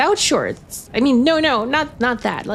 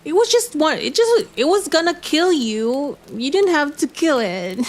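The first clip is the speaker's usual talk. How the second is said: high protesting voice; 6 semitones higher than usual. Real, not acted. Protesting the tiger's killing.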